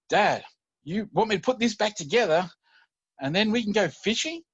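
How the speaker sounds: put-on high, loud child's question; eager little boy. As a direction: ask like an excited kid checking the deal. Son reacting to dad's challenge.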